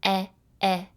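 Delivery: "eh, eh" sounded out on its own, short and open. Shown as a demonstration. Teacher sounding out eggplant's first sound for the quiz. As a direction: isolate the "eh" of eggplant. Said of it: "If I were to say the word eggplant".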